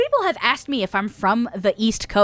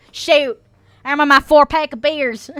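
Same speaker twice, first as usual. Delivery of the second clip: put-on Southern accent; 4 semitones higher, 4 dB louder than usual. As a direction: play it up as a Southern beer drinker. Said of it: "Valley May and Southern May".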